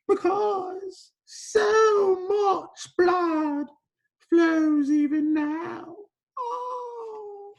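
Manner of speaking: very high, loud, drawn-out mock sobbing; theatrical wailing. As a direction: wail it slowly like a hammed-up tragedy, trailing into a moan. Acting Petro's despair over bleeding patient.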